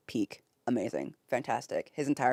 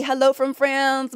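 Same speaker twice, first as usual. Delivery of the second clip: ordinary talk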